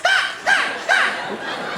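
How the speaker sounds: string of short high yelps; staccato high-pitched startle screams; repeated scared screams. Retold or imitated. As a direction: shriek three times in mock fright. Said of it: "me going like this".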